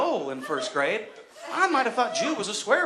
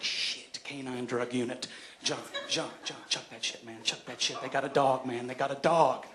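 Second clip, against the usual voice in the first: softer, lower panicked hush to his buddy; urgent hushed warning; 8 semitones lower, 10 dB quieter than usual. Retold or imitated. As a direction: hiss the warning so the cop won't hear. Hiding a joint from cops.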